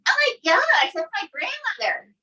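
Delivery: high-pitched mock dismay; retold daughter's shock. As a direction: imitate a daughter's appalled realization. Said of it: "And she says".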